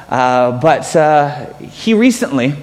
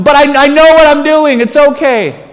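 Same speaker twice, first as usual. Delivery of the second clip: higher, louder, defensive shout; yelling back; 8 semitones higher, 6 dB louder than usual. Acted. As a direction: shout back that you know best. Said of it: "we're yelling back to him".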